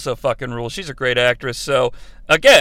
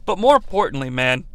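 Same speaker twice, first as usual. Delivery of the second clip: ordinary delivery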